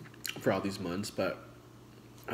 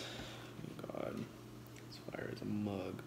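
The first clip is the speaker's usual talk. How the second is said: breathy whisper; 9 dB quieter than usual. Real, not acted. Savoring food.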